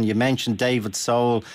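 normal talking voice

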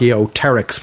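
"Archaeopteryx" said as archaeo-terix, no P; silent P. Shown as a demonstration. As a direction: drop the P in "Archaeopteryx". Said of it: "with the P being silent".

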